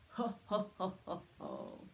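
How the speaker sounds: deep jolly ho ho ho; big deep Santa belly laugh. Acted. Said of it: "Very good, Santa".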